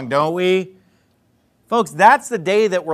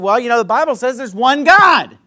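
mock forceful; 5 semitones higher, 5 dB louder than usual. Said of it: "I didn't say it in that voice".

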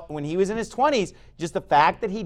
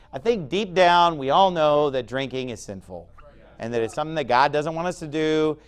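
normal talking voice

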